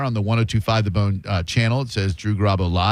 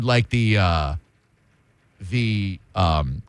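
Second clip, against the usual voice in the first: normal talking voice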